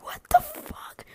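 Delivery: drawn-out, voiceless exclamation; whispered shock. Retold or imitated. Retelling a shocked reply to Misha on the phone, whispered. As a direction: whisper it slowly in disbelief.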